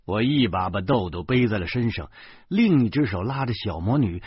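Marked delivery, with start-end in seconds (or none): none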